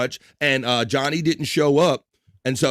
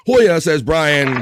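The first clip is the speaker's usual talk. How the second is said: loud drawn-out call; 3 semitones higher, 7 dB louder, much slower than usual. Real, not acted. Host shouting out a greeting.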